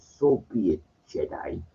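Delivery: quiet, calm Emperor, trailing to a hiss; just a hint of the sinister Emperor. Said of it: "the Emperor's calm".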